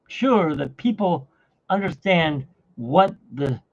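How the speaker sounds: ordinary talk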